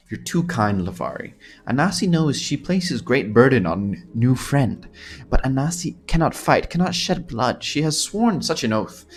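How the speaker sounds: put-on earnest Khajiit accent, climbing in pitch. Anasi burdens her new friend with danger, Khajiit accent. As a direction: plead gently as a Khajiit who cannot fight. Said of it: "is this a good Khajiit accent?".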